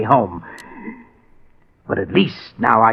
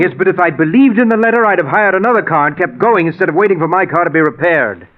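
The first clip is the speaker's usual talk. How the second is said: agitated self-blame; 6 semitones higher, 4 dB louder than usual. Acted. Husband insists he failed.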